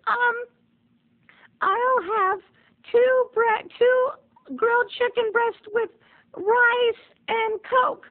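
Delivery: put-on very high, halting boyish voice; nervous ordering. Nervous boy ordering for date.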